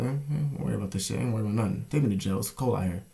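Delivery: ordinary talk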